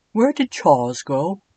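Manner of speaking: fretful upper-class English ghost; British Edwin voice. Acted. Edwin anxiously asking after Charles, prim British voice. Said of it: "my Edwin voice".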